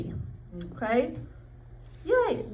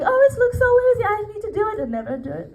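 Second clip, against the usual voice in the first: mock excited; 9 semitones higher, much faster than usual. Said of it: "said, like".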